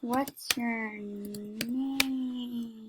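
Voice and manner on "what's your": drawn-out, soft question; not normal. Acted. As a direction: stretch the words out slowly, dragging "name". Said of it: "Okay, normal now".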